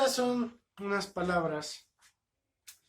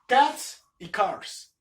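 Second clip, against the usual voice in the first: slow, deliberate; 2 semitones higher, 6 dB louder, much slower than usual. Shown as a demonstration. Teacher slowly presenting plural words to students. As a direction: stretch each word out slowly and loudly. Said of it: "teacher introduces plural words".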